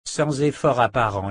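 usual speaking voice

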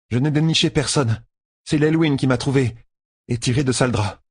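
explain, a touch defensive; a hint of Egwene explaining; Egwene's voice.

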